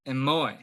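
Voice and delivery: "Emoi" as em-OY, stress on the second syllable; Greek pronunciation demo. Shown as a demonstration. Teacher showing students the first practice word, "Emoi" pronounced em-OY. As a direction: say "Emoi" clearly, falling on a stressed OY. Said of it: "how you pronounce this word".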